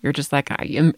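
ordinary talk, nothing marked

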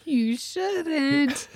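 high, drawn-out, half-hearted scolding; coy disapproval. Pretend. Mom echoing friend's loophole, high and coy. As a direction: sing out an unconvincing prohibition.

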